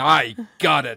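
slow it down like a movie hero; heroic "I got it". Retold or imitated. Imagined hero in the river, slow and proud. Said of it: "raised my hand up over saying".

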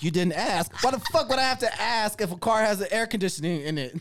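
loud, high outraged complaint; outraged at a car with no AC; indignant rant. Retold or imitated. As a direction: vent at the dealership. Indignant retelling dealership call.

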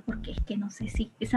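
susurro avergonzado sobre la palabra tabú; susurrando. Real, not acted. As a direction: bajar la voz a un susurro tímido. (susurro de vergüenza)

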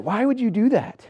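high, slow, plaintive why to the heavens; pleading complaint. Retold or imitated. Questioning Heavenly Father after quitting school, high pleading. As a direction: ask it like a wounded child.

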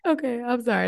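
ordinary delivery

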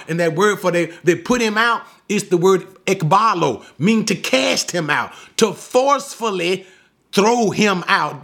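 ordinary talk, nothing marked

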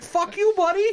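very high mock heckle with a rising end; comic high-voiced retort; imitated Canadian heckler. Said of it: "He's like".

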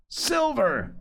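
high-pitched rallying cry. A narrator's reading. Mutineers acclaim their leader, high rallying cry. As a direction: cheer the name, pitched well up. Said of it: "they cried".

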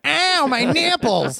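high, slow, comic wail; hammed-up cry of pain; silly clown yelp. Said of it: "someone going".

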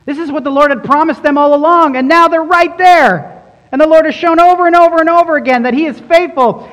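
high, loud, fast sermon peak; preaching at full force. Acted. Preacher marvels that Israel stands at the promised land, loud and high. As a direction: let the excitement burst out.

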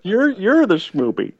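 high, cooing lovesick voice; mock lovey-dovey. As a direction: tease like a sappy boyfriend. Mocking lovey phone talk.

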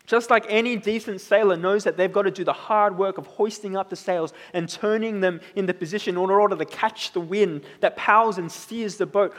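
ordinary talk

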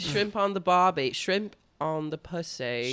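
mock Australian accent, sliding towards British; botched Australian accent. Riffing with the co-host on a catchphrase, botched Australian accent. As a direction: attempt Australian and land near botched British. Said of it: "sounded like a botched British accent".

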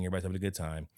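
ordinary talk, nothing marked

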